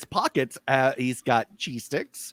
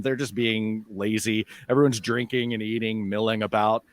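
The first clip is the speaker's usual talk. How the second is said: ordinary delivery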